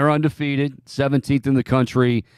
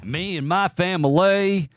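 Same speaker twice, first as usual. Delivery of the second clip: quick mock Southern twang, higher; Brian Kelly impression; 6 semitones higher than usual. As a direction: echo the coach's fake Southern twang. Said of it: "He picked up that Southern twang".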